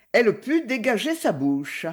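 ordinary delivery

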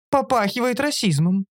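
high-pitched creature voice; creature concluding. A narrator's reading. Оша accusing the narrator, concluding. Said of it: "заключила Оша".

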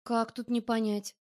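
usual speaking voice

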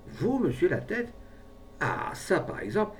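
high, rising amazed question; keen surprise. A narrator's reading. Brave man hears the pharmacist's offer, keenly surprised. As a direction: take it in with amazement. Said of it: "de l'expression de la plus vive surprise".

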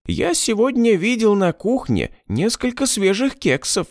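high sing-song puppet cat. A narrator's reading. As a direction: coax a child as a toy. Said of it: "продолжал говорить высоким голосом".

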